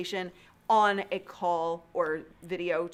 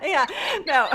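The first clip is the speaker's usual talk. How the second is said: laughing; 11 semitones higher, 10 dB louder than usual. Real, not acted. Both laughing at early meetings.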